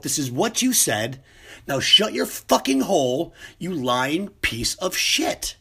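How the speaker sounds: screaming at the president, high and loud; furious shout. Pretend. Reporter finally snapping at the president, furious screaming. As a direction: let the reporter lose it completely, yelling.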